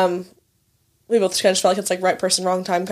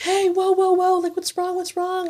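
higher mock-worried guys fussing; concerned strangers; 10 semitones higher than usual. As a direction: play the alarmed bar guys in a higher voice. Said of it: "and they're like".

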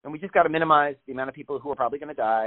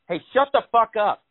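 mock yell at neighbor; 8 semitones higher than usual. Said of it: "my reaction to that would be like".

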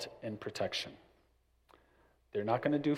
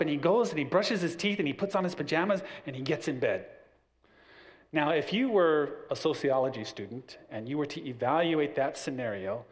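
ordinary talk, nothing marked

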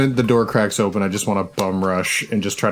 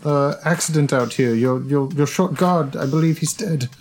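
higher, anxious plea with stammers; pleading stranger; 7 semitones higher than usual. Acted. Player character begging guard to open door, high pleading. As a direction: beg to be let in, feigning panic.

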